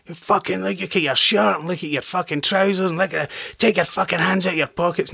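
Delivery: high-pitched imitated drill-sergeant tirade. Marine sergeant drilling the cocky new actor, imitated high angry tirade. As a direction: tear into the recruit in a mock rage. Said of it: "he starts drilling me down straight away".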